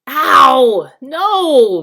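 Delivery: loud pained yell; bellowing. A narrator's reading. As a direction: bellow in pain. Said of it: "bellowed Trumpkin".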